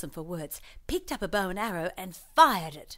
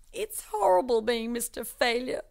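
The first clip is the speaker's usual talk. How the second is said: higher, mocking whine; mock lament; 5 semitones higher, 3 dB louder than usual. Mister Failure mocking the TV, higher bitter whine. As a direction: echo the winner bitterly.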